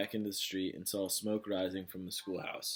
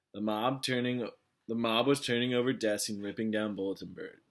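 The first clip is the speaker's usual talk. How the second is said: plain reading voice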